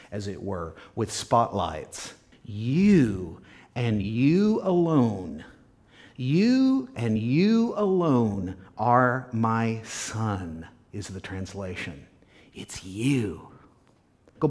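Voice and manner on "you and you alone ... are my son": "You" drawn out and set apart, slow and weighty; stress on YOU. Shown as a demonstration. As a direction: put a spotlight on each "You", taking the phrase slowly. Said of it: "setting the word up on a pedestal".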